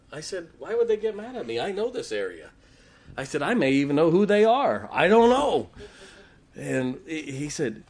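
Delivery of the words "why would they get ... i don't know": high and loud, half laughing; retold protest to Malachi; mock innocent. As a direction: act innocent, voice climbing.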